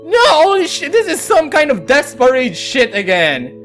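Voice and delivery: normal talking voice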